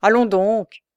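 loud scornful scoff; mild jeering dismissal; sneering. A narrator's reading. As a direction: wave it away with a sneer. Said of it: "ricana la mégère".

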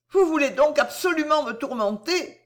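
a cry of shame and hurt; ashamed and pained. Acted. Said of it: "mourant de honte, de crainte et de douleur".

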